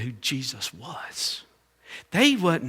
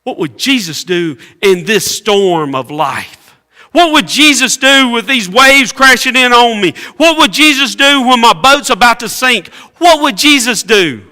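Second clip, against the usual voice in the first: louder and higher with each question; rising preached refrain; 8 semitones higher, 14 dB louder than usual. Acted. Preacher pressing the congregation in a climbing refrain. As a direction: hammer the repeated question home. Preacher building to full force.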